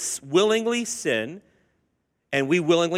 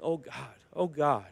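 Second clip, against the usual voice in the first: soft, broken repeated cry; hushed, heartbroken calling on God; broken plea; 3 semitones lower, 9 dB quieter than usual. Retold or imitated. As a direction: say it with tears in your eyes. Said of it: "it's broken".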